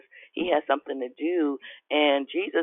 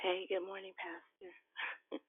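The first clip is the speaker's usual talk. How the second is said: faint, breathy morning greeting; soft and almost whispered; barely audible; 5 semitones higher, 20 dB quieter, slower than usual. Real, not acted. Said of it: "speak up".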